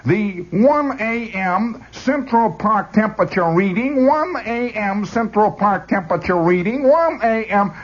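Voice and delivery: put-on high, slow Brooklyn accent repeating like a stuck tape. Jammed weather tape repeating itself in a high Brooklyn accent. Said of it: "when the tape gets balled up".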